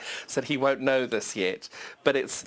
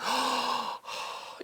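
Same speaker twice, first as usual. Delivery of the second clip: soft awed gasp; 6 dB quieter than usual. Real, not acted. Awed by solid fabric.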